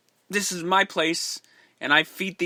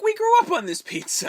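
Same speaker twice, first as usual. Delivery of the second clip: quoted much higher, put-on character voice; playfully imitating a film character in a much higher voice; high mock voice of a character. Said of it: "going like".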